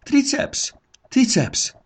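high call for the gurgó. A narrator's reading. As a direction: call the helper over. Calling the gurgó. (magasan kiáltva, hívogatva)

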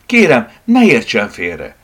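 loud, slow, insistent plea; urgent cry. Acted. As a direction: exclaim it, pleading to be understood. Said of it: "kiáltotta Tivadar".

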